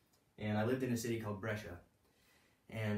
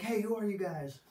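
attention-getting yell; 11 semitones higher, 6 dB louder than usual. Retold or imitated. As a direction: call out high and loud, not angrily. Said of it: "they started yelling at us ... just to get our attention".

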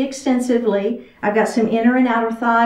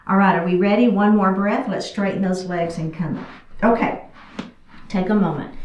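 ordinary delivery

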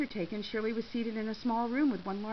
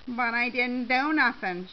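loud, drawn-out plea of innocence; yelled protest; 3 semitones higher, 7 dB louder, slower than usual. A narrator's reading. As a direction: protest loudly while being hauled off. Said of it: "Shirley yelled as she was dragged".

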